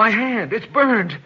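high, shocked cry of pain; pained alarm. Acted. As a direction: cry out at a sudden burn. Hand burned on touching her.

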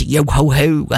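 a hint of a gruff pirate. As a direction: growl it like a pirate. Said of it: "I killed myself with my pirate voice".

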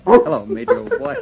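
high coaxing voice for the dog, now and then dropping soft; doting pet talk. Real, not acted. Husband greeting his big new dog, in high doting pet talk. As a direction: fuss over the puppy in a high sing-song.

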